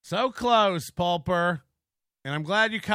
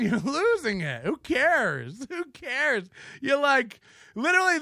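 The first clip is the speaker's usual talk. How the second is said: ordinary delivery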